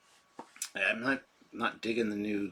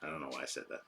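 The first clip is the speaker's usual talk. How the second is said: ordinary delivery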